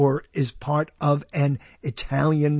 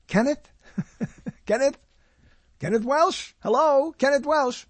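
calling out; 10 semitones higher than usual. Acted. Calling for missing caller.

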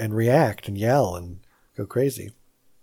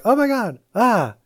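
a startled high-pitched oh my God; mock gasp of surprise at a killer; lightly hammed-up scare; mock fright; 8 semitones higher than usual. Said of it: "you're like".

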